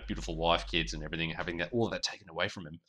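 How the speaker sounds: normal talking voice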